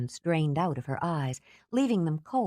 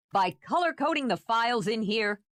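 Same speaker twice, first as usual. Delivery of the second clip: shouting; 6 semitones higher than usual. Acted. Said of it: "Since he was shouting now".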